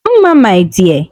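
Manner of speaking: slow, low and a touch worried; a hint of a trembling, anxious mother; trembling with worry. A narrator's reading. Mother gently calling her daughter, mildly worried. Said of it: "her voice trembling with worry".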